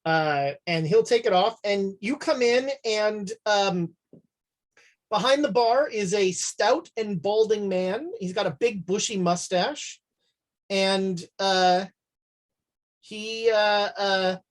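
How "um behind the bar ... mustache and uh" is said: usual speaking voice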